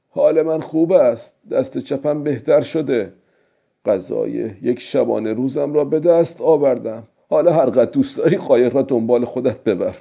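put-on old-man voice, starting high and easing down; old fisherman. Old man telling the fish he is fine, character voice.

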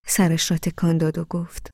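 ordinary delivery, nothing marked

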